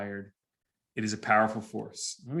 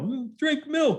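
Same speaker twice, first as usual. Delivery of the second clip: high-pitched imitation of an eager kid; kid yelling; 14 semitones higher, slower than usual. Student answers the speaker's question, high kid's yell. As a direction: call it out like a child in class. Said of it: "a kid will yell".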